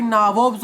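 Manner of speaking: "नाबोब्स" as naa-vobs, long first vowel; mispronounced nawab. Shown as a demonstration. Teacher repeating the answer for class, mispronounced "नाबोब्स". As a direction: distort nawab into "नाबोब्स" with rounded "o". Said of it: "wrong way में पढ़ते हो".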